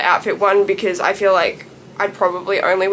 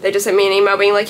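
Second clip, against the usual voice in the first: usual speaking voice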